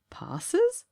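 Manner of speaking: high, puzzled echo of the word; can't believe what he just heard; surprised question; incredulous. Acted. Sanderson incredulous at the answer.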